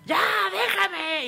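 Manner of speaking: high mock yell of a boy; childish shriek of protest; imitated child yelling. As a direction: yell it like the kid at the window. Boy shouting again.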